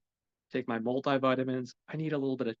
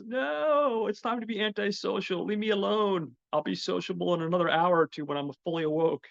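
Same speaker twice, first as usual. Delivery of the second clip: grumpy morning brain; 7 semitones higher than usual. Pretend. His groggy brain telling everyone to go away, high and whiny. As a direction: play his brain begging to be left alone. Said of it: "My poor brain knows".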